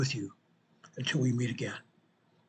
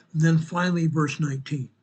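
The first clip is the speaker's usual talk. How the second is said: ordinary talk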